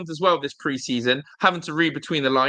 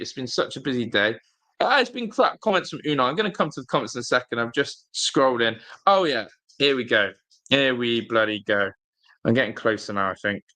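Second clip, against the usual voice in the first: ordinary delivery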